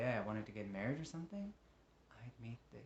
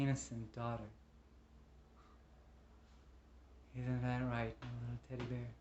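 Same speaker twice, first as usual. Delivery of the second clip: tender pleading; 7 dB quieter than usual. Acted. Man begs his love for his daughter, tender pleading. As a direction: plead gently, with halting softness. Tender plea to beloved and child.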